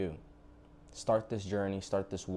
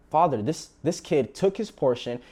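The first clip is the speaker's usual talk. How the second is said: indignant son; 6 semitones higher, 6 dB louder than usual. Retold or imitated. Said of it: "He's like".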